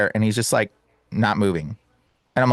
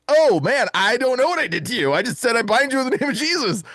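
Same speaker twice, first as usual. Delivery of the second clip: flustered alarm; 9 semitones higher than usual. Retold or imitated. Flustered reply to panicking man.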